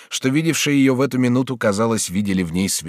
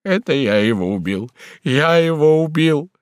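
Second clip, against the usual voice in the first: anguished cry; 5 semitones higher, 4 dB louder than usual. Acted. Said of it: "вскрикнул Бюва".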